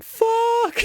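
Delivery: high, loud cry of anguish; an anguished high shout; mock anguished yell. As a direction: yell it out in pain. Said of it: "I was just like".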